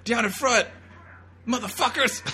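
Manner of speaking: heckling shout. Pretend. Joking viewer yells at the crowd in the theater, loud heckling shout. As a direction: yell at the people blocking the view.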